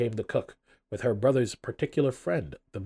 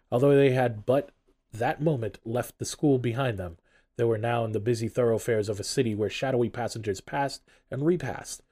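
plain reading voice

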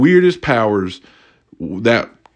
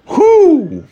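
ordinary talk

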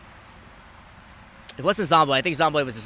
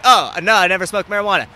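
sudden loud denial; 5 semitones higher, 8 dB louder than usual. Retold or imitated. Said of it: "I was like".

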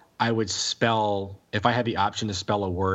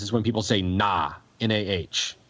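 normal talking voice

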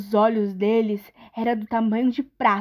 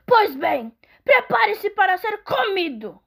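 higher, menacing troll; 8 semitones higher than usual. Acted. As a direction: threaten like a hungry troll. Troll threatens middle goat.

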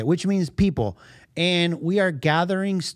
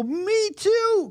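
excited squeal. Retold or imitated. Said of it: "And I go".

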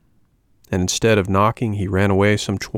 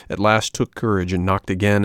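ordinary delivery

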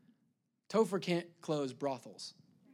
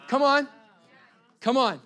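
shouted praise, much louder; 6 semitones higher, 9 dB louder than usual. Real, not acted. Pastor rallying the congregation, shouting come on. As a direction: call out to the church, loud and high.

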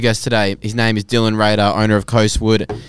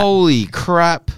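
awed surprise; 7 semitones higher, much slower than usual. Real, not acted. Seeing the gift figure.

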